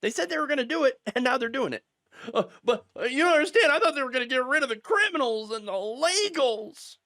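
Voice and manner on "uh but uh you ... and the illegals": whiny, put-on voice of a clueless voter; mock voice of a regretful Trump voter; mocking naive voter. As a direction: sneer through the quote as a gullible supporter.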